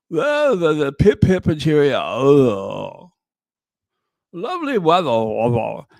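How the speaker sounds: a light put-on upper-class English accent; lightly hammed-up stuffy English lord with a plummy lilt; pip-pip-cheerio posh English accent, gently mocking. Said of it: "like, old stuffy English lord".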